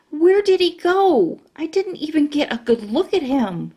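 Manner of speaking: high, eager question; eager curiosity. Acted. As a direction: crane to see him. Eager searching.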